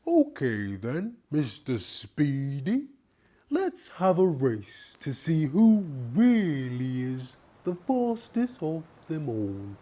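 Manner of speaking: high, slow turtle voice laying down a challenge; high, measured turtle announcing a race. Acted. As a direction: throw down the challenge proudly. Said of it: "he announced".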